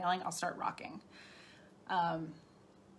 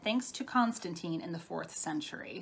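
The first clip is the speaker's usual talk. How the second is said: normal talking voice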